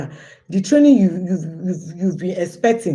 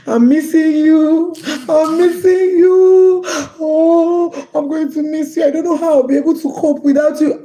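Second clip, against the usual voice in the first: imitating her crying; 8 semitones higher, 4 dB louder than usual. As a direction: mimic the friend sobbing down the phone. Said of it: "she was crying".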